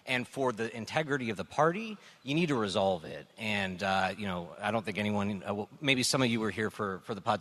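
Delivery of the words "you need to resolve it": ordinary talk, nothing marked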